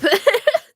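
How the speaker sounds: high burst of laughter; helpless laugh. Real, not acted. Laughing after sneezes.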